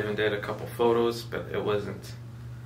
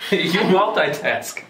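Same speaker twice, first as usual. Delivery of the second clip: laughing; 9 semitones higher, 9 dB louder, slower than usual. Real, not acted. Teasing girlfriend, laughing.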